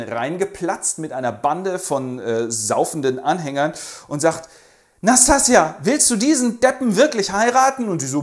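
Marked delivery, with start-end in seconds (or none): 5.0-7.9 loud, high bellowing demand; rowdy challenge. Acted. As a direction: barge in and call her out. Rogoschin platzt betrunken herein.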